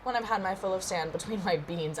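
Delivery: usual speaking voice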